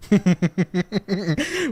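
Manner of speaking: high, rapid chuckle; long giggling run, amused; laugh. Real, not acted. Getting cussed out is so funny, high giggle.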